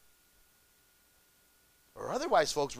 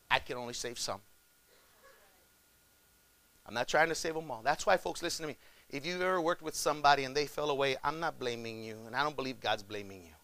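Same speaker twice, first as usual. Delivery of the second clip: usual speaking voice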